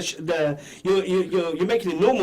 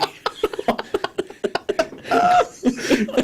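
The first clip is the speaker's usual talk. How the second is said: high breathy laughter; breathless laugh. Real, not acted. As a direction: wheeze with laughter. Joke about ghusl sports.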